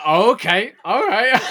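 laughing. Retold or imitated. Acting her own awkward reaction.

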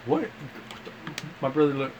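normal talking voice

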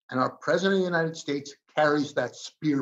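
usual speaking voice

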